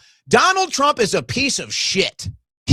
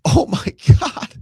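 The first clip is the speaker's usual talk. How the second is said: laughing in disbelief; oh my God said through real laughter; 5 semitones higher than usual. Real, not acted. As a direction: break into a stunned laugh. Laughs at Trump's post.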